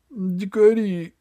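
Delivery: put-on high, slow childlike echo; high drawn-out Дикари; dazed old man. A narrator's reading. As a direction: repeat the word blankly like a scared child. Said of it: "согласился Меркурий".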